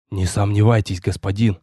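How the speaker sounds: low-pitched, subdued hunter voice; low, confident reassurance; assuring hunter. A narrator's reading. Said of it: "уверил меня охотник".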